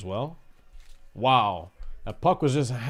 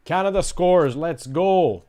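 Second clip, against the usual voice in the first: excited cheer; 5 semitones higher, 8 dB louder than usual. Real, not acted. Celebrating a goal on stream.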